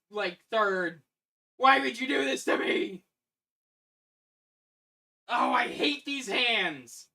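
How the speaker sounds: wailing in loud frustration; crying with rage. Real, not acted. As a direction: whine it out, furious and defeated. Despairs at hated hands.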